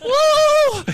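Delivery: high, loud church whoop; mock revival shout; preacher's whoop. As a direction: let out a hammed-up woo.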